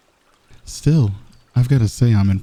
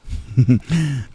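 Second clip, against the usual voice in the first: smug chuckle; 4 semitones higher than usual. Acted. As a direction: chuckle to yourself, pleased and teasing. Smug villain teasing the listener.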